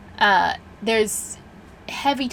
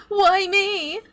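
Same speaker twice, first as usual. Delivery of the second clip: very high, louder mock-plaintive cry; mocking wail; 12 semitones higher, 4 dB louder than usual. Computer villain mimics tortured captives, escalating mock wail. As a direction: push the mimicked plea up into a wail.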